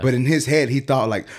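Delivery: ordinary talk, nothing marked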